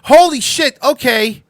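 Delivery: high-pitched, loud burst of surprise; excited recognition. Real, not acted. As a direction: blurt it out, voice jumping way up. Excited recognition of man in clip.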